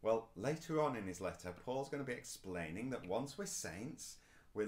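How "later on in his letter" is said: ordinary talk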